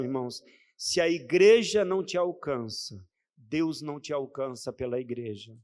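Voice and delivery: usual speaking voice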